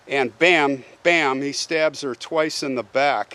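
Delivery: ordinary delivery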